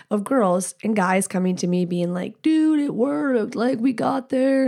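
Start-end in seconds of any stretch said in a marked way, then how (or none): 2.4-4.7 drawn-out, higher-pitched mock amazement; lightly acted breathless-news "dude!"; imitating excited listeners. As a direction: retell it as thrilled fans, higher and slower. Retelling excited listeners' feedback.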